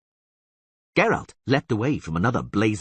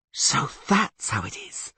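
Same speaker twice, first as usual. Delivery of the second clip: hissed; 5 semitones higher, slower than usual. Acted. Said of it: "she hissed".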